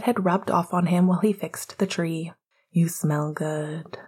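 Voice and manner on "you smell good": slow, intimate whisper; soft whisper. Acted. Remus murmuring to Sirius while dancing, slow whisper. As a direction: murmur it close to his ear.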